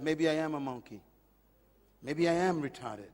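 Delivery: quiet, low, defeated; sad self-talk. Retold or imitated. Preacher voicing his hurt child self, quiet and low. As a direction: murmur it like a beaten child. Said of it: "I said to myself".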